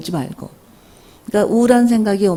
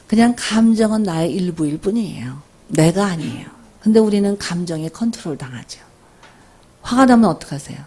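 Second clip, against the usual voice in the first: ordinary delivery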